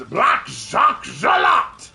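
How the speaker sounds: slow, loud, hammed-up French announcement; cartoon French accent. Naming the first French Looney Tune seen, cartoon French accent. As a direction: proclaim the name in a big French villain accent. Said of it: "the first French Looney Tune".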